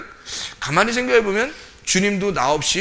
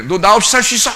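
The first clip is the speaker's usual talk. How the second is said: loud, higher, bold challenge; 당당하게; 4 semitones higher, 7 dB louder than usual. Acted. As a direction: demand it with full confidence, acting it out. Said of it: "예수님이 당당하지, 우리한테". (당당하게 큰 소리로 따져 묻듯)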